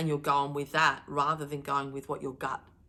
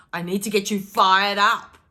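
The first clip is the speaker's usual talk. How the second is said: much louder, energized delivery; fired up; 11 dB louder than usual. Real, not acted. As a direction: rev them up, full energy. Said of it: "My tone raises".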